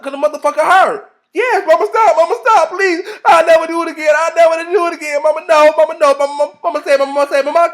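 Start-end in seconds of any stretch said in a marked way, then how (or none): 1.3-7.7 high, loud hammed-up wailing; mock screaming plea. Child begging Mama to stop hitting, mock screamed pleading. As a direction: beg frantically like a child mid-whipping. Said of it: "so she screamed".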